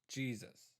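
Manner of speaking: ordinary talk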